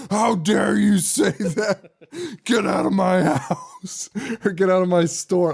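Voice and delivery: slow, laughing mock outrage; hammed-up huffy manager; outraged manager. Manager throwing Dan Haggerty out, mock outrage. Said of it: "the manager's like".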